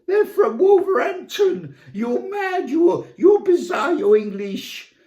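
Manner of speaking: high squealing mock indignation; hysterical mock outrage. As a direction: shriek the accusations, half crying. Mock outrage, high and crying laugh.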